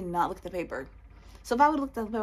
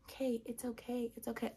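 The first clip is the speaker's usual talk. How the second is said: soothing; 3 semitones higher, 9 dB quieter than usual. Retold or imitated. Mother comforting her crying daughter, soothing.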